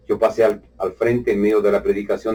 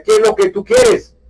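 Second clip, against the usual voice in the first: mock hostile challenge; 5 dB louder than usual. Confronting the pastor friend.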